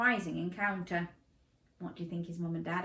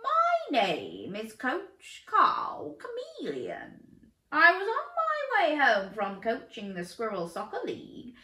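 higher, slow, friendly coach voice; coach chameleon voice; 6 semitones higher, 4 dB louder, slower than usual. Acted. Coach introduces himself to Dan in a slow voice. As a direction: play the enthusiastic coach.

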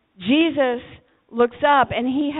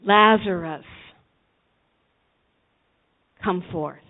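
commanding call; 6 semitones lower, 7 dB louder, slower than usual. Retold or imitated. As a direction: call the dead man out of the tomb. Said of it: "and he said".

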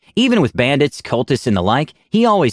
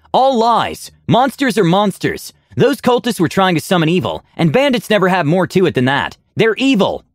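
heated, high-pitched tirade; narrated shout; 8 semitones higher than usual. A narrator's reading. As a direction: insist vehemently, pitch staying high. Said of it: "Aura shouted".

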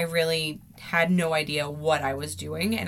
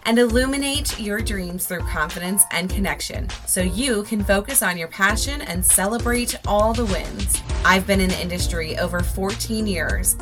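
upbeat, polished host intro; podcast intro; 2 semitones higher, 5 dB louder than usual. Acted. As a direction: read it like an upbeat show opener. Scripted podcast intro over music.

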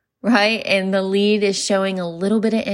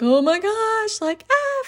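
panicked squeal; 13 semitones higher than usual. Retold or imitated. Panicking over a new lead.

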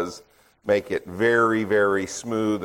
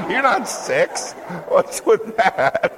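higher, slow, laughing through words; laughing teasing; 9 semitones higher, slower than usual. Real, not acted. Speaker teasing crowd who missed the joke, laughing. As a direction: tease them while still laughing.

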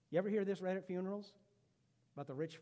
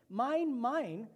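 put-on childish mine; 5 semitones higher, 6 dB louder than usual. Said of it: "is a three-year-old talking".

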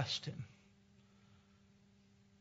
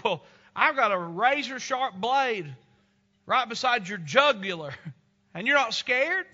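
captain's challenge; 6 semitones higher, 8 dB louder than usual. Retold or imitated. Husband challenges wife in story.

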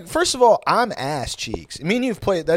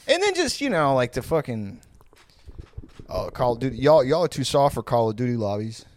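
ordinary talk

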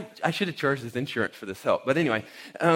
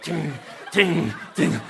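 imitated door noise; 2 semitones higher, slower than usual. Automatic door closing on the man, imitated repeated tings. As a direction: mimic a mechanism bumping over and over. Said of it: "the door's just, like, closing on him".

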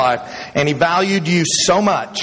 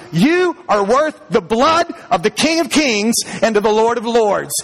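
higher, rising preacher's cry; preaching at full force; 5 semitones higher than usual. Acted. Pastor urges a doubting congregation, fervent and high. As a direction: build to a fervent, high-pitched appeal.

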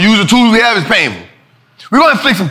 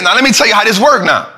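ordinary delivery

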